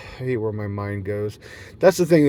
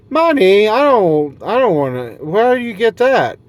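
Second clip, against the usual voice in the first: much higher and louder, clueless squeal; mock baffled shriek; 9 semitones higher, 8 dB louder than usual. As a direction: play dumb, totally shocked by money. Baffled by need for money.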